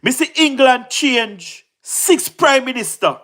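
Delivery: normal talking voice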